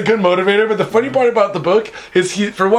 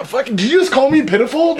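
higher, offended comeback; mock indignant retort; 7 semitones higher than usual. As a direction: bristle at the insult. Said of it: "And I'm like".

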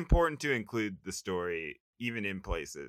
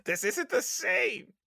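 Rocco impression; 8 semitones higher than usual. Cohost doing Rocco refusing change, high whiny voice. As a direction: whine like a grumpy cartoon wallaby. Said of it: "pretty good Rocco impression".

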